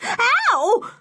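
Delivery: high-pitched yelping howl; howl of fright. A narrator's reading. Woman nearly bitten by the dog, high howl. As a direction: cry out sharply, very high. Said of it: "howled Missus Stick".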